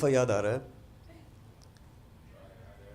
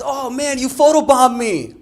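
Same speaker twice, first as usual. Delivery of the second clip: friend's exclamation; 13 semitones higher, 12 dB louder, slower than usual. Retold or imitated. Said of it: "he says".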